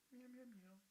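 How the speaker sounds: high, soft mock meowing; playful meow. Real, not acted. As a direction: meow at the cat sweetly. Meowing at cat.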